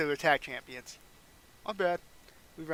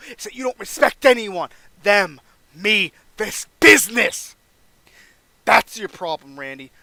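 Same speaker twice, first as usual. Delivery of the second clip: louder and louder, higher, driving each word; heated scolding; 4 semitones higher, 9 dB louder than usual. Pretend. Veteran scolding the young champion, heated. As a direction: lay into him.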